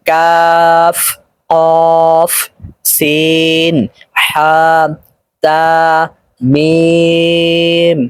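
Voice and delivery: "কাফ, কফ, সিন" and the rest with long steady vowels; drawn-out letter recitation. Shown as a demonstration. Teacher reading the next line, "কাফ" to "মিম" drawn out. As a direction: stretch each name slowly, longest on "মিম". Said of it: "টান সহকারে আয়ত্ত করতে হবে".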